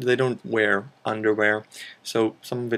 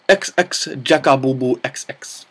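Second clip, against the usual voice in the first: put-on slips into a comic Japanese accent on the name; thanking a viewer in a mock Japanese accent; 4 dB louder than usual. Thanking the list writer, in a mock Japanese accent. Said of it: "That was suddenly a very Japanesey kind of accent".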